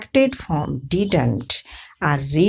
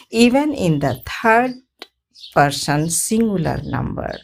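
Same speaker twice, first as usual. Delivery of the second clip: ordinary talk, nothing marked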